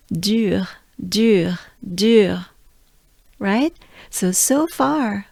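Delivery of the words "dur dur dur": "Dur" on a good breath, French throat R. Shown as a demonstration. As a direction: repeat "dur" slowly for learners to follow. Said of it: "Let's take a good breath together".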